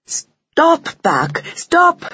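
high-pitched urgent cry; young female mouse's alarmed plea. A narrator's reading. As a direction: cry out to break up a fight. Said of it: "she cried".

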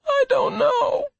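very high, slow pained groan; drawn-out, high-pitched misery. A narrator's reading. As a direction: groan it like an injured boy. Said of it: "Lenny groaned".

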